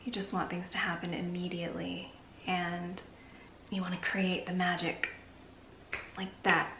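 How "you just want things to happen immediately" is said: normal talking voice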